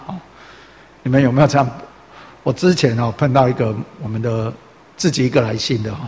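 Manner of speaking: usual speaking voice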